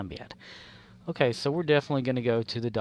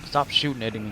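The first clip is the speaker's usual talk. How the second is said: ordinary delivery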